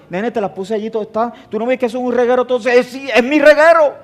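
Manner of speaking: a mock wife answering back; voicing the wife. Voicing wife's reply. (imitando a la esposa, sube y termina fuerte)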